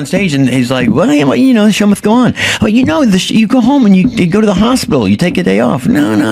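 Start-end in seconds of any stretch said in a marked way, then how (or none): 0.9-2.3 high pitched-up Leno voice, a bit louder; mock Leno brushing off his injury; Jay Leno impression. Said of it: "That's a horrible Jay Leno". 2.6-6.3 mock Leno voice. Mocking Leno's logic in his voice.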